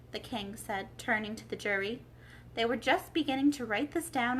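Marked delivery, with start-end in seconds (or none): none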